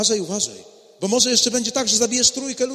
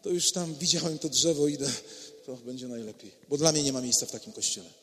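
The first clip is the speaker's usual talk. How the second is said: soft, defeated murmur; resigned despair; 7 semitones lower, 9 dB quieter than usual. Acted. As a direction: give up quietly, no hope left. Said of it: "i powiedział".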